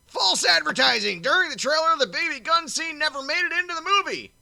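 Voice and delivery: high, loud put-on voice reading the review; loud squeaky mocking read. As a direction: ham up the bot review in a silly high voice. Reading bot review in mock voice.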